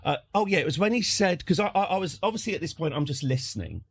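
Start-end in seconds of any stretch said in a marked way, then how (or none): none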